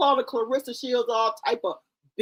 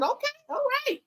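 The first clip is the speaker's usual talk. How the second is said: mock surprise; 14 semitones higher, 4 dB quieter, faster than usual. Reacting to Punch's threat.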